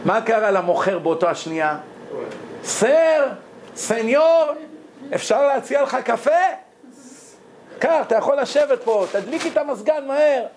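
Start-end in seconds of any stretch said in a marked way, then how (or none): 2.6-10.4 high eager voice of a servile shopkeeper; fawning seller. Acted. Shopkeeper fawning over the rich man's son, high and eager. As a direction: gush over the rich customer, pitched up.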